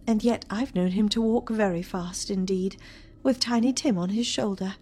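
high, wistful mother's voice; sorrowful mother. Acted. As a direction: remember him fondly, then call out. Mother speaking in play.

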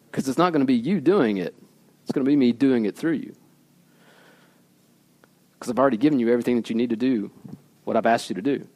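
low, soft and steady reassuring; gentle reassurance. Acted. God reassuring a scared Moses, soft and low. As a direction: settle their fear quietly.

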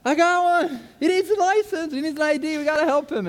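high, loud, bursting with excitement; excited. Retold or imitated. Rushing into social services to tell the worker, high excited shouting. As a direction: blurt it out like you just won. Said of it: "and I'm all excited".

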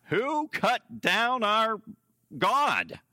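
loud, high, drawn-out outraged shout; steaming mad. Acted. Preacher voicing the furious men of the city, steaming shout. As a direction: bellow each word slowly in outrage. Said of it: "were steaming".

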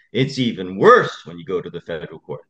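ordinary delivery

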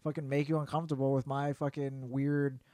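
normal talking voice

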